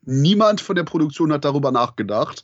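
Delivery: normal talking voice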